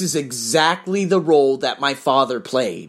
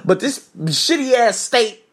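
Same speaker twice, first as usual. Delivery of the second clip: higher-pitched, mildly indignant tirade; imagined angry rant; 6 semitones higher than usual. Pretend. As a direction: keep up the pretend rant, stretching the insults. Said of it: "you know how angry I would be".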